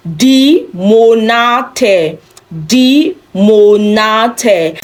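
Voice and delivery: die Monate, stretched slowly syllable by syllable; slow German model. Shown as a demonstration. Teacher drills plural for class, slowly. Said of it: "And then plural".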